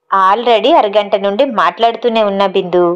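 ordinary delivery

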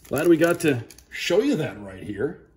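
ordinary delivery

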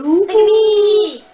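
loud, high, drawn-out call; stretched-out high yell; attention-seeking shout. Real, not acted. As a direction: holler slowly for everyone to look. Friend calls out for attention.

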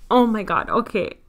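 ordinary talk, nothing marked

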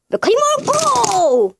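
high, drawn-out launch call; battle cry. Pretend. Reviewer launching toy Utah to transform, drawn-out battle cry. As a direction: call out a cartoon hero's catchphrase, stretched.

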